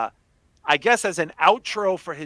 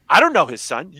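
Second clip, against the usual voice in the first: louder retold denial, firmly insistent; dead serious; 3 semitones higher, 8 dB louder than usual. Retelling the daughter's denial, dead serious. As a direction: quote the child with emphatic seriousness. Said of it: "dead serious".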